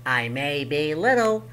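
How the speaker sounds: small goblin laying down the law; first goblin. Acted. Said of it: "said the goblin".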